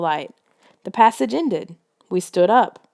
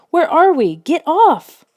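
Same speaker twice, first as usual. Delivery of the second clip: high, loud frantic yells; panicked shout; 9 semitones higher, 5 dB louder, slower than usual. A narrator's reading. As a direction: panic after the fall. Nicole panicking in pit.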